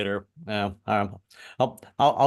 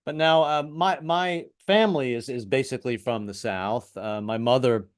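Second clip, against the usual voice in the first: ordinary talk, nothing marked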